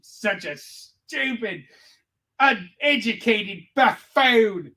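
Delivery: high, slow dopey American voice; mock apology. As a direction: grovel in a dumb voice. Continues the dumb-American bit.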